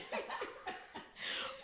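breathless, squeaky, choked-up laugh; a laugh that gets stuck in the throat. Real, not acted. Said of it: "I can't even laugh 'cause I'm choked".

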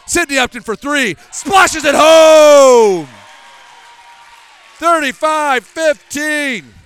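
big excited play-by-play call; excited shout. Real, not acted. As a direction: yell the made three. Three-pointer, excited play-by-play call.

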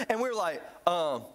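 normal talking voice

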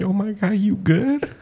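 laughing concern. Real, not acted.

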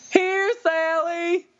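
high, drawn-out call across the yard. Retold or imitated. Farmer calling his llama from the tree line, high hollering.

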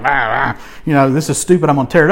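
low, dismissive mutter mocking the Bible; mock scoffing. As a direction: sneer it like a scoffer tossing the book aside. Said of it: "I don't mean like".

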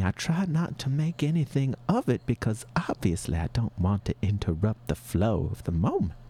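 ordinary talk

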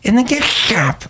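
high sing-song shop lady; Shelley Shuhart voice. Acted. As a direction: gush in a high character voice. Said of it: "It's Shelley Shuhart".

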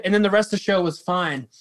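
ordinary delivery

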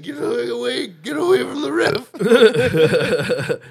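high, loud, slow laughing yell; get back coach. Retold or imitated. Imitating a get back coach yelling, loud and laughing. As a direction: bark like the coach wrangler. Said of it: "he's like".

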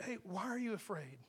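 soft, calm, without reproach; gentle question. Acted. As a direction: ask softly, as a caring friend. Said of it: "I can't see that here".

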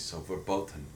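"verboten" said low and soft with a crisp T. Shown as a demonstration. Correcting the co-host's German, "verboten" with a T sound. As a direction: say "verboten" with a T in place of the D. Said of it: "instead of D's, it'd be T's".

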